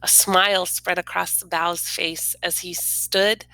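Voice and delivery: ordinary delivery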